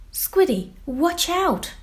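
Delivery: high, loud cry of alarm; warning shout. Acted. A friend warning Squiddy before he bumps the whale, high shout. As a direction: shout to stop him in time.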